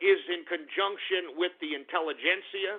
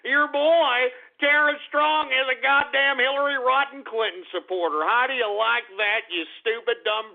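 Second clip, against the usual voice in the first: gloating shout; 5 semitones higher, 5 dB louder than usual. Real, not acted. Gleefully exposing her politics.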